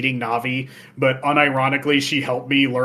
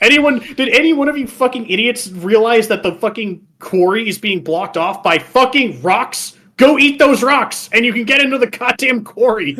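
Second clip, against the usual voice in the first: loud exasperated rant, higher; mock rant; 8 semitones higher, 5 dB louder than usual. Player venting at the picky starving Gorons, loud mock rant. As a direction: yell at them in disbelief. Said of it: "ranting at Gorons".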